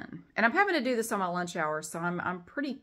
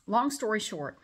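ordinary delivery

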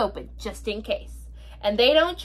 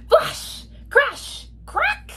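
dramatic storm words; much slower than usual. Acted.

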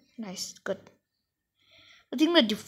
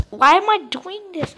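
ordinary talk

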